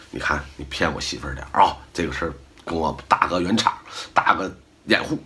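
low, soft sneaky ask; hushed favor request. Retold or imitated. A lightly voiced friend asks you to lie to his wife, hushed. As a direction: murmur it like a friend plotting a cover story. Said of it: "他跟你说".

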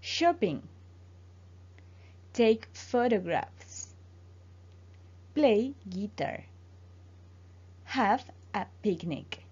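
slow, careful English model phrases for repeating. Shown as a demonstration. Teacher to Spanish-speaking student, pronunciation drill: slow careful model. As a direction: say each phrase slowly so the student can copy it. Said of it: "repite después de mí".

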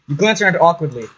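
usual speaking voice

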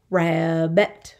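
"Rabbit" split in two, ra-bbit; syllable by syllable. Shown as a demonstration. Teacher counting two syllables, "Rabbit" in parts. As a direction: say "Rabbit" as two drawn-out beats. Syllable lesson, word broken up.

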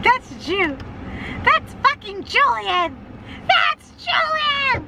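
high, slow, drawn-out cry; shrieking recognition. Retold or imitated. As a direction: wail the name in disbelief. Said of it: "It's like".

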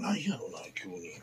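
whispered puzzled aside; a quiet whispered puzzled aside. Real, not acted. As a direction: wonder it under your breath.

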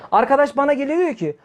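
ordinary talk, nothing marked

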